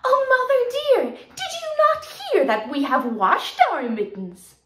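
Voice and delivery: high, loud, fast eager kitten voices; mock-excited kittens. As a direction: rush to tell mother. Kittens proudly report.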